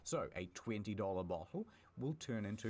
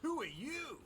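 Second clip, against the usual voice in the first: squeaky surprised guard; high startled voice. Acted. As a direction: yelp it in a high voice. Data center guard voice.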